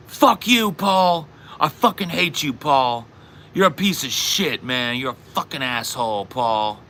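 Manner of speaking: high angry outburst, easing off; drunk T J. Retold or imitated. Drunk friend turning on the returning storyteller, mock angry yelling. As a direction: imitate a drunk suddenly turning abusive. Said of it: "He flipped it on me".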